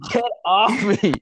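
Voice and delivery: high, loud hammed-up recoil; mock protest. Acted reaction to the whispering opponent.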